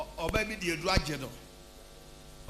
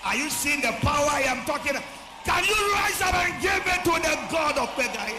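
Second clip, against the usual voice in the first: loud, high preacher's call to the crowd; preaching at full force; 9 semitones higher, 7 dB louder than usual. Real, not acted. Preacher rousing the congregation with a loud high call. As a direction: rouse the church to give praise.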